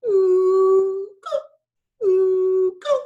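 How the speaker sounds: very high, loud falsetto ooh go; high falsetto frog calls. Shown as a demonstration. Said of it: "that kind of a sound and then going even higher".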